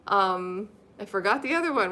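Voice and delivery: usual speaking voice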